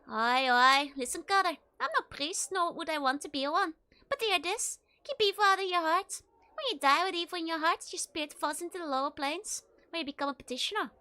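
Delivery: earnest Scottish warning; Scottish brogue, grave advice; Scottish accent. Acted. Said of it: "like some Scottish person".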